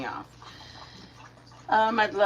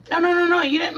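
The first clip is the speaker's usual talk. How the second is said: eager reassurance; 10 semitones higher, 6 dB louder, faster than usual. Real, not acted. Reassuring a viewer.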